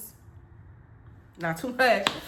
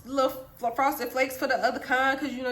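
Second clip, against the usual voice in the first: ordinary talk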